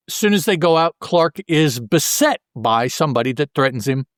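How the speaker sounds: usual speaking voice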